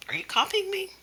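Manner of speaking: high-pitched pretend indignation; mock mad. Teacher to the copycat child, mock mad. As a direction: act put out, voice pitched right up. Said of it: "act like I'm getting mad".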